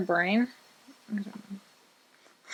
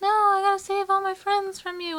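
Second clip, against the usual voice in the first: mock heroine voice; 11 semitones higher than usual. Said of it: "And she's like".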